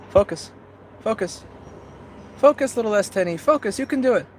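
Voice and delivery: pleading with a stubborn lens; coaxing camera. Real, not acted. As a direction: sweet-talk the camera into focusing.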